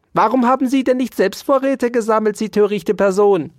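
fast, high, loud reproach; angry snapping. A narrator's reading. Dauerspeck blames the mouse for gathering no stores, snapping. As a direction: snap the scolding out rapidly. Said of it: "fauchte Ambrosius Dauerspeck sie an".

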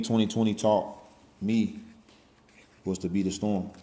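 ordinary talk

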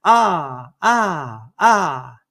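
loud, separate cries of "ah"; mimicked echoing scream. Mountain repeating the son's scream, echoed cries. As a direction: echo a cry back, one "ah" at a time. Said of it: "hears the voice repeating".

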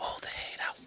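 breathy whispered echo of the chant. Acted. Cohost whispers the intro chant back. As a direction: whisper it back like a hype man.

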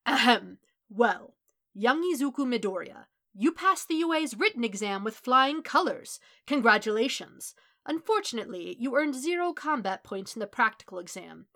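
loud, grand hero proclamation; All Might. A narrator's reading. Hero gives a student exam results, loud and theatrical. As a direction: clear the throat, then announce it big and hearty.

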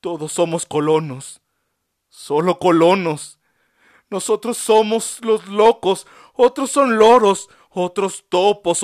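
high, loud, passionate defiant monologue; impassioned speech. A narrator's reading. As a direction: plead your dignity loudly to the doctor. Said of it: "monologó con honroso modo".

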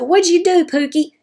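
a hint of a cheerful mother's voice; imitating mama. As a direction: play her mama answering brightly.

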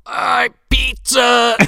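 slow, high hollow skeleton call; goofy skeleton shouting slowly. Pretend. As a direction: drag out the words like a talking skeleton. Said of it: "[name]'s skeletal mouth".

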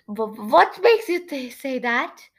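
acted jittery questioning; nervous stammer. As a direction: stumble on the first word nervously.

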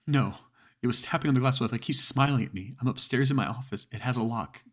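high, loud and rushed with terror; panicked caller. Acted. Frightened woman telling the dispatcher about the face, panicked. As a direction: plead for help as if something is at the window. Terrified caller on emergency call.

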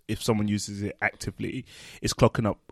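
ordinary talk

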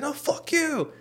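very high, squeaky comeback; retold angry retort. Brother curses right back, mock fury. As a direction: fire it back as the imitated brother.

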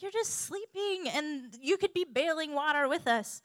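high, loud, whiny complaint; annoyed. Acted. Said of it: "they were probably annoyed that Jesus wasn't helping".

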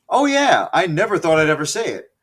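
high, loud and fast "oh yeah"; excited. Real, not acted. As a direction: hype up the reveal. Teasing the big con reveal.

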